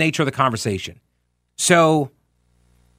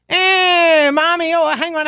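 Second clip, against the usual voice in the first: mocking teacher impression; 12 semitones higher, slower than usual. Teacher mocks an excuse-making kid, high and taunting. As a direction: taunt like Mister Peacock, pitched way up. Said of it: "this is what he would do to us".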